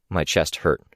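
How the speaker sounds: usual speaking voice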